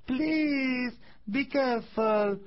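high, tiny creature voice; tiny pleading voice. A narrator's reading. Piece of wood begs the carpenter to stop, tiny gentle plea. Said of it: "a tiny voice begging him gently".

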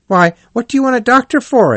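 high, fast, startled question; surprised Rat. A narrator's reading. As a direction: blurt it out in alarm.

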